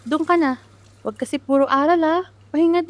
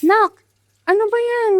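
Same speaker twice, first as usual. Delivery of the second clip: startled call; 6 semitones higher, 6 dB louder than usual. Acted. Mother startled by daughter.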